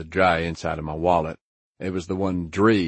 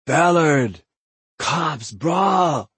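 high, loud warning call; yelling from afar; 10 semitones higher, 7 dB louder, much slower than usual. A narrator's reading. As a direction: shout the alarm from the front door. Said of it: "Dwayne yelled from the front door".

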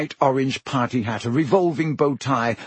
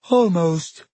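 sad; 5 semitones higher, 4 dB louder than usual. A narrator's reading. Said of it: "said the ghost sadly".

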